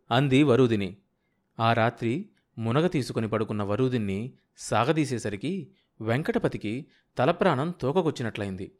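ordinary delivery

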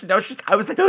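usual speaking voice

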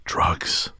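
whispered, conspiratorial aside; confidential hush. Retold or imitated. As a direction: lean in and whisper it like a secret. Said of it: "he leans in and says".

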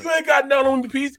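loud, high imitation of a braggart; mock taunting. Lifter bragging to Peasy after racking, loud mock taunt. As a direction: crow it at a rival. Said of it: "talking crazy to him".

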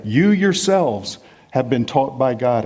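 "You" leaned on, slow and weighty; slow down and press hard on "You yourselves"; stress on YOU. Shown as a demonstration. Preacher stressing to the church that "You" is emphatic. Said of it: "The "you" is emphatic".

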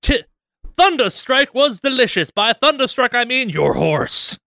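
high hammed-up Henry Ford. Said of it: "Let Henry Ford at 'em".

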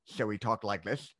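slushy lisped "this"; lateral lisp. Retold or imitated. Student imitating a classmate, lateral lisp. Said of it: "he had a lateral lisp".

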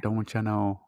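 soft, tentative try at an Irish accent. Shown as a demonstration. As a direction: try the Irish accent under your breath. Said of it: "Don't you know is the only accent part".

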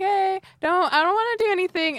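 high, loud, hurried reassurance; placating. Acted. Player approaching the foe with hands up, acted placating. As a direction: talk fast to show no threat.

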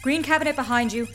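urgent orders. Acted.

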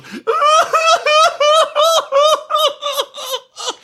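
high keening wail, hammed up; exaggerated crying howl; mock wailing cry. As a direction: wail like an old grandmother in the corner. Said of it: "He goes".

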